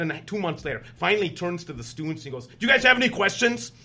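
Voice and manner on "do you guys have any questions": high, loud retold challenge; imitated loud demand. Upset senator turns to the students, loudly demanding. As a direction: throw the question out as an imitated yell.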